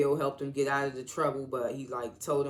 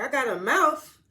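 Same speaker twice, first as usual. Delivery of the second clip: put-on grumpy old uncle; 10 semitones higher, 7 dB louder than usual. Uncle snaps back at Ashley.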